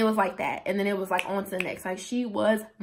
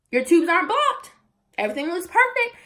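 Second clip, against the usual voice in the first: much higher and louder, beaming doctor; excited good news; 9 semitones higher, 8 dB louder, faster than usual. Retold or imitated. Doctor telling the patient her tubes are clear, excited. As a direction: deliver the news like a celebration. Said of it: "she's like".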